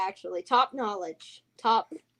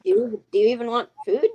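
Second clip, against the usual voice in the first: exasperated; 7 dB louder than usual. Pretend. Acted exasperated greeter.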